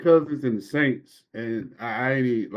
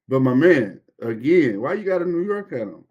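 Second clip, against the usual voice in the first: usual speaking voice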